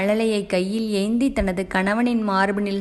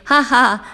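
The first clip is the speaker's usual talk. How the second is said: teasing laugh; 3 semitones higher, 6 dB louder, much slower than usual. Acted. Teasing laugh after onion trick.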